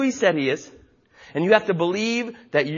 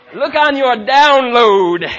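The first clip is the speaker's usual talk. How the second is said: louder and very slow, each word pressed; slow emphasis; 3 semitones higher, 9 dB louder, much slower than usual. Acted. Pastor urges congregation to check their downloads, slow and loud. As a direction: lean on it so they can't miss it. Pastor hammering home the point.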